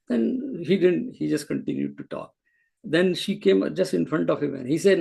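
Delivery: ordinary talk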